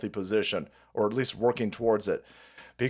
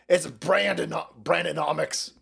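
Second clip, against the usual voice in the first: ordinary talk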